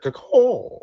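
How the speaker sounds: high squawking caw; bird caw. Pretend. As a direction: squawk like a crow. Bird call.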